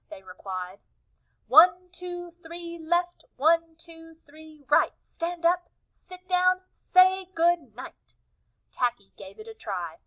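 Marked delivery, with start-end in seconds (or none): 1.5-8.1 high, tidy sing-song cheer; prim, careful chant; proper penguin cheer. Acted. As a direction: team showing Tacky their neat cheer. Penguins repeat their proper cheer.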